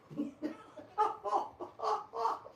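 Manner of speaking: high-pitched hyena cackle; hyena laugh. Real, not acted. Laughing like a hyena of evil, high cackle. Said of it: "They want me laughing like a hyena".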